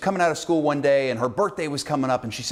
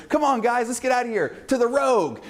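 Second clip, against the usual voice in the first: higher, bubbly mock cheer; perky teen; 11 semitones higher than usual. Cheerleader calling friends to her car, higher perky mock voice. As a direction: chirp it like a happy teen. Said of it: "She goes".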